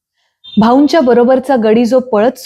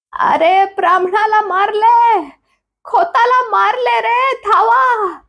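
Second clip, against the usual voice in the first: much higher, slow alarmed cry for help; old woman crying out; 13 semitones higher, slower than usual. A narrator's reading. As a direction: shriek the alarm so the village hears. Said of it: "म्हातारीने ओरड केली".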